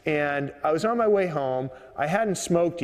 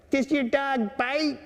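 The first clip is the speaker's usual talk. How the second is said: high, slow East Coast woman's voice; East Coast accent; 10 semitones higher than usual. Retold or imitated. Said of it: "that kinda rough East Coast kinda accent".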